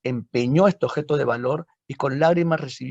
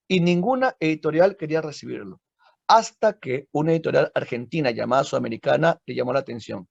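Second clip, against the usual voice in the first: ordinary delivery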